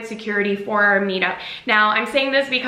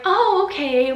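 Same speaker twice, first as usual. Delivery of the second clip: accommodating; 9 semitones higher than usual. Retold or imitated. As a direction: play along politely in a raised pitch. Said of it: "thinking he's just nervous or something".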